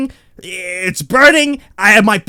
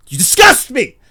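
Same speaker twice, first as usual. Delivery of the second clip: mock revulsion, raised voice; disgusted; 4 semitones higher, 4 dB louder than usual. Telling off his own little Joey, disgusted. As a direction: punch out the disgust, louder on the middle.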